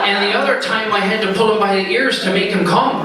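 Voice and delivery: can't hold the giggle while delivering the last line; laughing through punchline. Real, not acted. Teller to party guests at the punchline: laughing through the words.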